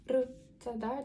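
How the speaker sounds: ordinary talk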